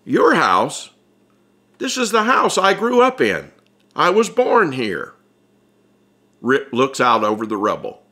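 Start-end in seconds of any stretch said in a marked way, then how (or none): 0.0-5.1 a higher, half-joking protest; lightly voiced, wounded but grinning; Ripley indignant. Ripley tells Bud this was his childhood home, mildly indignant. Ripley claims the old house.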